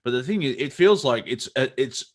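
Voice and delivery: ordinary talk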